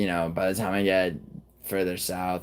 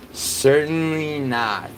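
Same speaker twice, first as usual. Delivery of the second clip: "certainly not" stretched slow and higher; slow, emphatic "certainly not"; 6 semitones higher, slower than usual. Shown as a demonstration. Streamer saving Wales for last, slow and emphatic. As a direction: drag out "certainly not" for effect.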